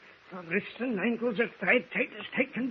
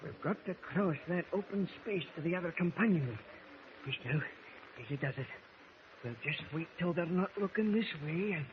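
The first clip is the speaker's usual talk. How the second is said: softer, hiding in the open; hushed sneaking; 9 dB quieter than usual. Acted. Sneaking past the mutineers unseen.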